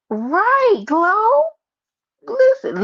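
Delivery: high and loud, hyping up the chat; hyped call-out. Real, not acted. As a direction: shout the names out like greeting friends.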